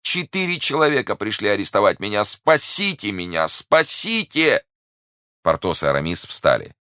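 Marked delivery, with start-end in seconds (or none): 0.0-4.6 high-pitched cries for help; desperate pleading. A narrator's reading. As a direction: beg urgently, stretching the last cry. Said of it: "воскликнул он". (отчаянная мольба высоким голосом)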